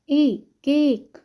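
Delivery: केक with a drawn-out "ay" vowel; long A sound. Shown as a demonstration. Teacher showing the sound of letter A, long A in केक. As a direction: say ए, केक slowly, stretching the "ay". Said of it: "pronounce like ए".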